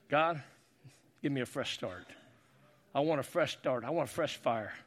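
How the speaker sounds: hushed, pleading altar prayer. Real, not acted. Leads a closing prayer.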